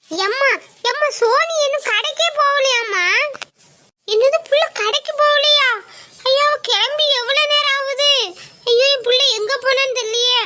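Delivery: high, loud, panicked wailing mother. Acted. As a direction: cry out in alarm for the missing daughter. Mother panics daughter missing.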